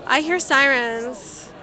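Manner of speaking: usual speaking voice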